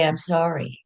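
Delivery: usual speaking voice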